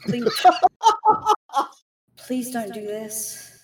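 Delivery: pleading, voice breaking into tears; quivering voice. Acted. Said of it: "with a quivering voice".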